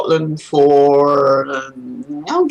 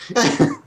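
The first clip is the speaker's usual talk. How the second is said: short laugh; 6 semitones higher than usual. Real, not acted. Laughs at the story.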